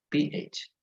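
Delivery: ordinary delivery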